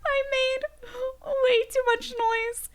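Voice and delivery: high, loud, laughing panic; panicked wail. Real, not acted. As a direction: wail in half-laughing terror. Panicking as clicker attacks.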